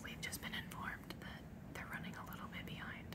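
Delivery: soft unvoiced whisper. Real, not acted. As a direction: whisper so no one hears. Quiet place, whispering.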